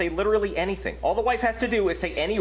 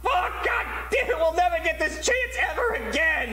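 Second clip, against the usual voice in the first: angry shout; 8 semitones higher than usual. Acted. Losing the million dollars.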